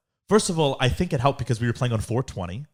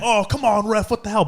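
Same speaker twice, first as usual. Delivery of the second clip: mock heckling; 8 semitones higher, 3 dB louder than usual.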